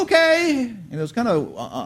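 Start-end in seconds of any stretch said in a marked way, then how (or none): 0.0-0.7 high, unsure and hesitant okay; imitating a boy unsure of himself; strange uncertain okay. Friend on the back of the bike answers hesitantly, high and uncertain. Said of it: "he says this kinda strangely".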